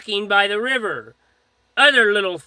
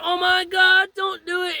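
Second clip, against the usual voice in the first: high-pitched panicked voice, retold; mock-alarmed high pleading; imitated frightened plea; 9 semitones higher than usual. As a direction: mimic a wife begging, pitched way up. Retold wife pleading in fear.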